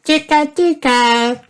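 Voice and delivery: put-on tiny, very high toddler voice; George. A narrator's reading. George tickling Grandpa with spider legs, high toddler voice. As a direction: tickle with a high, drawn-out little voice. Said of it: "said George".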